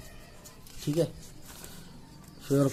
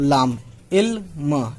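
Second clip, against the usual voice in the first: slow teacher's sounding out of इल्म; drawn out sounding; much slower than usual. Shown as a demonstration. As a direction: stretch इल्म slowly, syllable by syllable. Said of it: "teacher sounds out word".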